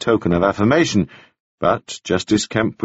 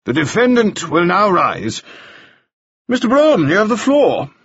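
higher, commanding judge's ruling; presiding judge giving orders, Mister Broom raised high; stern judge; 11 semitones higher, 3 dB louder than usual. Acted. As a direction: call the court to order, crisp and firm. Justice orders the court.

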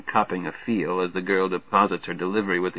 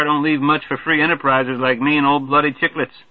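put-on high, loud, hearty good-ol'-boy; brash Major Marvey; 5 semitones higher, 3 dB louder than usual. Marvey hollering a greeting to the newcomer, brash and hearty. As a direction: boom the welcome like a glad-hander.

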